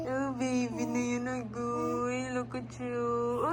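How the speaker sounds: usual speaking voice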